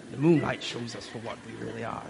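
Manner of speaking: put-on pirate-chief accent, held back a little. Preacher repeating the pirate chief's great line, pirate accent. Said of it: "Maybe I'll do the accent this week".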